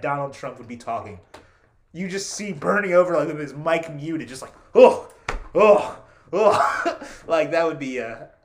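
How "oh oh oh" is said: loud weary "oh" groans; exasperated groans. Retold or imitated. Bernie muted at the debate, groaning loudly.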